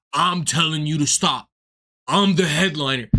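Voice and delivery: low, mock-authoritative voice; mocking the headliner. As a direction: put on a deep, self-important headliner. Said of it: "come up to me and talk about".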